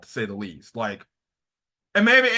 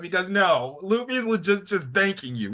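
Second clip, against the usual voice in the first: usual speaking voice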